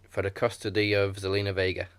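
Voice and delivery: normal talking voice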